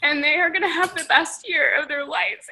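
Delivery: softly quoting a friend's heartfelt reassurance; tender reassurance. Retold or imitated. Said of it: "and she's just like".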